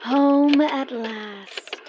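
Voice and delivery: very slow, high and loud, savouring each word; grand slow homecoming; drawn-out arrival. Acted. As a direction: sigh out the relief of coming home.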